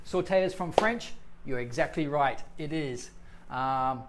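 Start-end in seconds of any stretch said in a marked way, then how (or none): none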